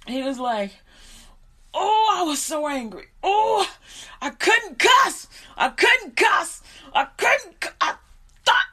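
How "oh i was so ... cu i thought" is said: high, loud, slow frustrated complaint; strained angry lament; imitating furious dad. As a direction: vent like a father holding back curses. Father retells his anger.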